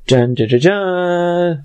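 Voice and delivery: fast sung fanfare jingle; dramatic fanfare. Pretend. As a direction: hum a quick dramatic sting. Sound effect for police arrival.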